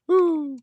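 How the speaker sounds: very high, loud "woo"; whoop. Real, not acted. Streamer after greeting a viewer, high loud whoop. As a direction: cut loose with a high whoop.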